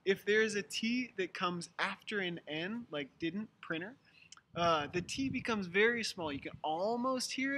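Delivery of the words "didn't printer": "didn't", "printer" as "didn", "prinner"; silent T. Shown as a demonstration. Teacher giving American examples, silent T. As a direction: let the t after n vanish in "didn't" and "printer". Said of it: "this T tends to go away".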